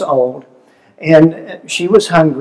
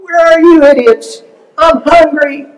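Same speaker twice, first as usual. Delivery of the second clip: put-on high, louder squeaky baby voice; mock baby demanding food, pitched way up; voicing a demanding baby; 12 semitones higher, 6 dB louder than usual. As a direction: play the hungry infant yelling for her bottle. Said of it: "Just, just like".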